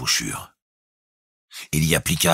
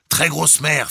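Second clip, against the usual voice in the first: high, raised report; calling out; 12 semitones higher, 4 dB louder, slower than usual. A narrator's reading. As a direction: call the report down the tube. Captain answers through speaking tube. (annonce lancée à voix haute)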